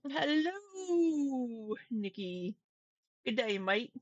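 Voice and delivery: drawn-out Australian greeting, starting high; badly faked Aussie hello; fake Australian accent. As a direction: do a horrible, playful Australian accent. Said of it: "fake Australian accent".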